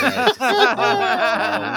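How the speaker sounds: high, loud laughing talk. Real, not acted. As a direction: squeal through the laughter. Laughing at bad line.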